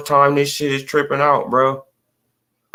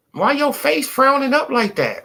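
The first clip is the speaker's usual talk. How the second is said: much higher, teasing mock question; high taunting first take; 12 semitones higher than usual. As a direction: tease him in a lifted voice. Repeats the mocking question.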